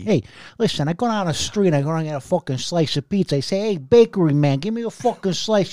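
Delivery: hammed-up Italian-American street guy; mock Italian-American. Customer ordering from bakery man, mock Italian-American accent. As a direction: play a pushy Italian-American pizza customer. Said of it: "we have to talk like that all the time".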